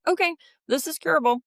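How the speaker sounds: a high, brisk and cheerful reassurance; lightly voiced upbeat oncologist; upbeat doctor. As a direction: deliver good news brightly. Oncologist brings hopeful news.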